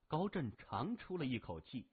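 ordinary delivery

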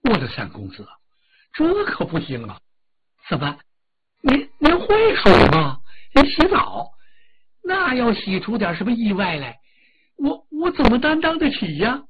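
high, loud, near-tearful begging; panicked pleading. Acted. Steward begging young master not to swim, panicked and near tears. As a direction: plead with him in a trembling fright. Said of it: "可把管家李兴吓坏了".

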